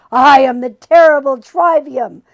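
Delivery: loud grand villain proclamation; booming demon boast; boastful demon. A narrator's reading. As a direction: announce yourself like a demon. Demon boasts his titles.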